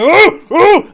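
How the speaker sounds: very loud, high-pitched "oh" yelled out; acted alarm shouts, bursting out high; shouting. Game master as waking bandit raising the alarm, shouting. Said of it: "he starts shouting".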